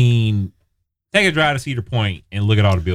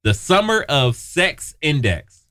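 slow lisping through a grill; lisping with a dental grill in; 3 semitones higher, much slower than usual. Real, not acted. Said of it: "that probably sounds terrible".

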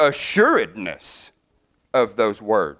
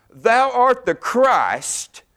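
slow proclamation; 10 semitones higher, much slower than usual. Retold or imitated. Repeated quote slowed.

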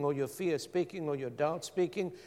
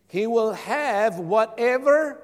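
loud, higher, slowed, left hanging on "whatever"; emphatic prompting; 7 semitones higher, 9 dB louder, slower than usual. Real, not acted. Preacher cueing the congregation to finish the verse, emphatic prompting. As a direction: proclaim it and leave it open to finish.

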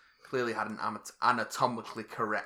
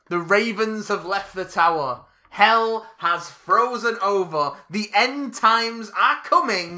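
ominous proclamation; 7 semitones higher, 9 dB louder, much slower than usual. Pretend. Mock doom announcement of news.

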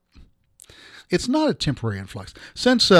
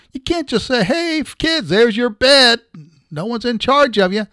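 mock caretaker; 8 semitones higher, 3 dB louder than usual. Mock caretaker addressing kids.

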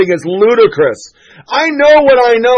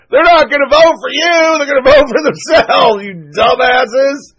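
fired up rant; 5 semitones higher than usual. Real, not acted. Host railing at liberals, fired-up shouting rant. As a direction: get hot and climb to a yell. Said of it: "I get fired up on abortion".

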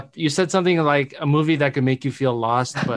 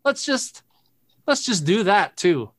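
high sarcastic quip; 6 semitones higher, slower than usual. Retold or imitated. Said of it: "And I go".

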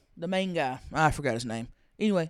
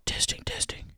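unvoiced mic test whisper; 11 dB quieter than usual. Shown as a demonstration. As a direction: whisper into the microphone. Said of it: "when she whispers into the microphone".

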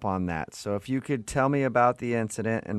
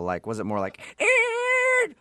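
tire screech; slower than usual. Shown as a demonstration. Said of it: "how long the tire screeches are".

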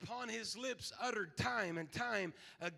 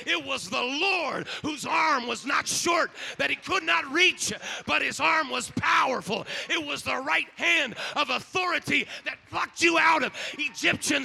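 loud, rising preacher's cry; full-force preaching; 2 semitones higher, 10 dB louder than usual. Acted. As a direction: preach it to the back row with fire.

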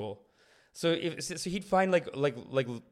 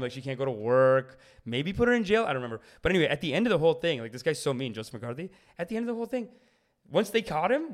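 ordinary talk

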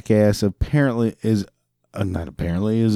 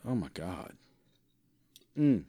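ordinary talk, nothing marked

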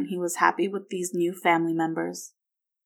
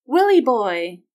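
loud, higher affectionate call; 4 semitones higher, 8 dB louder, slower than usual. A narrator's reading. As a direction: call out to a grandson. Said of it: "Margaret called".

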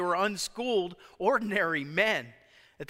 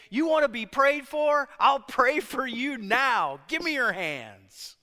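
raised, emphatic voice, slowing into a firm command; bold offer; 4 semitones higher, 4 dB louder than usual. Acted. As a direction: offer prayer boldly, right here and now. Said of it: "Boldness is saying".

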